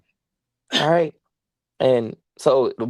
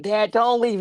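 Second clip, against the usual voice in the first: pitched way up, a mock tearful plea; imitating crying plea; 9 semitones higher than usual. Donkey begging dad not to leave, imitated high crying plea. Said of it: "He's crying like".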